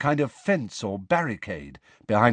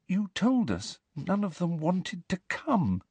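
frightened; 8 semitones higher, 5 dB quieter than usual. A narrator's reading. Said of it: "her wand now shaking in her hand".

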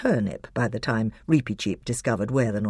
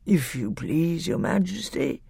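thick, sleepy voice; 4 semitones higher than usual. A narrator's reading. The bear humbly addresses Peter, thick and sleepy. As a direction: draw it out thickly, half asleep. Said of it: "sleepy voice said".

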